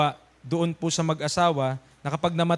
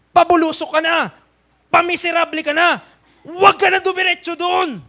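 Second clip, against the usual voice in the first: high, loud preacher's warning; fervent warning; 9 semitones higher, 6 dB louder than usual. Acted. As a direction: warn the flock from the pulpit. (mataas at malakas na babala)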